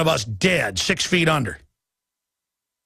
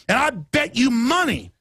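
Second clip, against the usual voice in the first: ordinary talk, nothing marked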